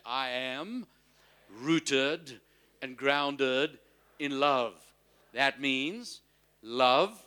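short phrases with pauses for repeating; leading a confession. Real, not acted. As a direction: lead the congregation phrase by phrase, emphatically. Leads congregation repeating after him.

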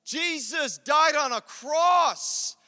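slow, loud preached declaration; preaching. Real, not acted. Preacher proclaims a man's stand in adversity, slow and loud. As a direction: proclaim it with force.

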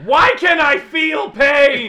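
slow shouted cry of pain through laughter; anguished toaster shout. Pretend. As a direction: wail like a suffering toaster.

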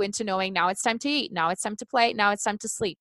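ordinary talk

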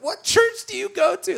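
high, pleading mock-tearful question; imitating emotional woman. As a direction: ask it as if losing it. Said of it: "starts losing it".

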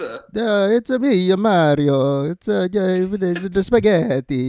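high, hammed-up Italian accent, Mario style. Quoting the game's uncle, high hammed-up Italian accent. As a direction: play a cartoon Italian plumber. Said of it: "that's actually a line".

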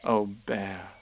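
low, tender sigh; fond Christopher Robin. A narrator's reading. Boy to his bear after the adventure, fond. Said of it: "said Christopher Robin".

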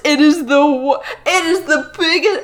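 exasperated laughter through words; sputtering with laughing outrage; half laughing, half crying; laughing rant. Real, not acted. Ranting about the book.

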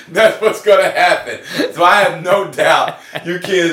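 big real laugh over the words; cracking up, loud and high; laughing hard. Real, not acted. Host cracking up at his own joke, real laughter.

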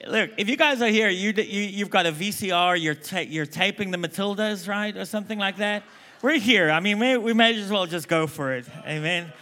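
real excited call to the crowd; rallying the crowd. Real, not acted. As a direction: fire up the room. Hyping the crowd.

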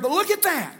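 high, impassioned pulpit shout; fervent preaching. Real, not acted. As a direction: preach it at full force to the congregation. Preaching at full force.

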